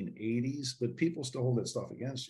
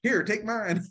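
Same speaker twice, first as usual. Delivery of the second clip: put-on old man teasing; 9 semitones higher, 3 dB louder, slower than usual. Old man offers his beer.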